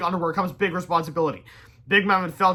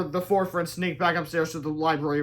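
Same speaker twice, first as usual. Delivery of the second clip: ordinary delivery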